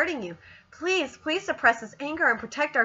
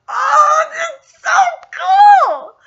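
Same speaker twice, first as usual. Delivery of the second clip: sudden high, loud squeal of delight; excited gush; 13 semitones higher, 12 dB louder, slower than usual. Real, not acted. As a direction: burst out squealing with joy. Fan gushing over sweet manga scene.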